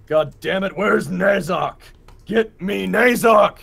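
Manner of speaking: loud, high yell with stretched-out words; angry shouting. Acted. Guard captain yelling at people for Nazok, angry shouting. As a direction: bellow it so it carries through a wall. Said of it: "Wheeler kinda shouting around".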